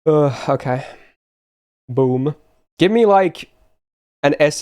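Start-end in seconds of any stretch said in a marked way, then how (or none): none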